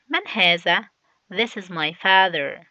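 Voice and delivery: normal talking voice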